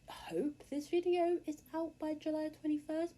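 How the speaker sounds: a quick whisper, half to herself; whispered aside. Real, not acted. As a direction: breathe it out as a private aside. Whispered aside about release date.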